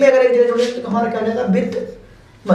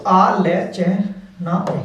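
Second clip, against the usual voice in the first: slow spelling out; much slower than usual. Shown as a demonstration. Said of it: "इस तरीके से ए ले और चैना".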